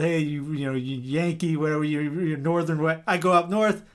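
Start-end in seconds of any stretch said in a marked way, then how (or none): none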